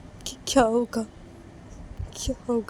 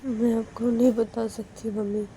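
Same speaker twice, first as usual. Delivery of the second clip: teary pleading; 4 semitones higher than usual. Acted. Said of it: "Manisha फिर से रोने लगती है".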